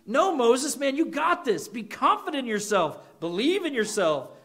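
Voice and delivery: hammed-up motivational coach; mock pep talk. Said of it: "Notice how God did not say".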